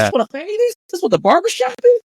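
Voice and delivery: high, wide-eyed amazement; astonished disbelief. Retold or imitated. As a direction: marvel at it like a newcomer. Amazed at barbershop culture, retold.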